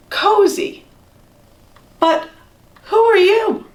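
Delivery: high, startled mouse question; surprised. Acted. Mouse finding tiny strangers in his bed, startled and high. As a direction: catch your breath at strangers.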